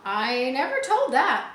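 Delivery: loud, slow protest; squire cries out. Acted. Squire protests loudly to the captain, crying out. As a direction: cry out in denial. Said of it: "the squire cried".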